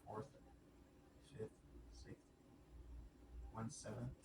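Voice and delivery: ordinary talk, nothing marked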